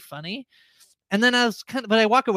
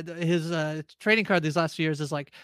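usual speaking voice

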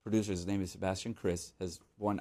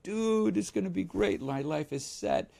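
high, giddy mock hype; excited friends; 7 semitones higher than usual. Friends imagining a perfect life, high mock excitement. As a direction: gush like a dreamy fan. Friends hyping up record news.

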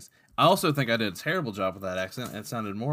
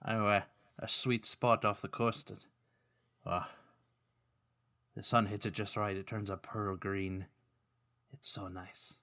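hushed, dreamy mock-Irish; [name]'s Irish accent; 2 semitones lower, 6 dB quieter than usual. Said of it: "Speaking of bad accents".

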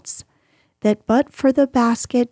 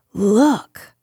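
mildly indignant exclamation; understated narrated outrage. A narrator's reading. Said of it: "exclaimed Missus Medlock indignantly".

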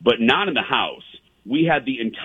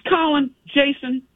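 high mock guide voice calling out; imitating Sally calling names. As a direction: sing out their names like the chirpy guide. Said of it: "she goes".

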